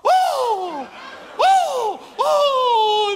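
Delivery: loud, high "oh!" of outrage; three hammed-up shocked wails; scandalised gasping cries. Landlady insulted about how she earns her living, scandalised cries.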